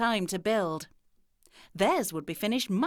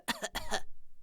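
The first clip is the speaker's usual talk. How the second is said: fake cough; 6 dB quieter than usual. A narrator's reading. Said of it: "said the wolf".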